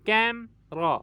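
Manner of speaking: Camera split with a pause, कैम, then रा; syllable break. Shown as a demonstration. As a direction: break camera into two separate beats. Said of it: "इसमें दो syllable हैं". (Camera को रुककर दो हिस्सों में)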